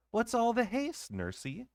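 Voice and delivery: put-on light, high little girl calling; a young princess, puzzled and out of breath; child voice. A narrator's reading. Child asks nurse while running.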